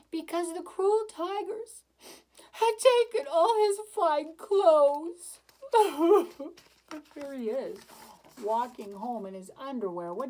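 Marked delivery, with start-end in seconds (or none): 0.1-5.4 higher, louder, slow lament; storyteller milking the sad moment; dramatic sorrow. A narrator's reading. 5.6-6.6 short bright laugh. Real, not acted. Laugh after sad line.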